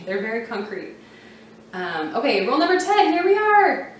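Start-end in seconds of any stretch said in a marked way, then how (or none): 2.5-3.9 higher and louder, bright announcement; excited announcement. Real, not acted. Teacher excitedly introducing rule ten to viewers.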